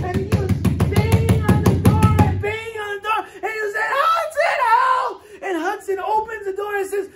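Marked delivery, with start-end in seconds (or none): 4.0-5.2 very high, loud cry of the name; frantic call for help. Retold or imitated. Man outside shouts for Hudson, high and panicked. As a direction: yell his name through the door in panic. Man banging on door calling.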